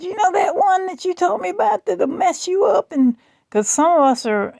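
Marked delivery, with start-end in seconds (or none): 0.0-2.9 panicked friend. Retold or imitated. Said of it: "She said".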